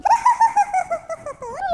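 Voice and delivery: high-pitched eerie cackle in a woman's voice; shrill womanly laugh. Retold or imitated. Possessed husband laughing like a woman, shrill cackle. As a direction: imitate a possessed, shrieking laugh. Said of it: "tertawa melengking dan suaranya kayak suara perempuan".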